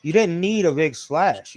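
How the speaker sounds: ordinary delivery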